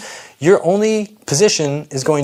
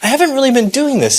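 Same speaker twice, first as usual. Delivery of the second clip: a higher, quick, lightly voiced inner voice of a beginner; self-talk; 10 semitones higher, faster than usual. Trainer voices a viewer giving themself grace, lightly higher and quick. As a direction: talk yourself kindly through being out of practice, a little higher. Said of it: "give yourself a little bit of grace ... and say".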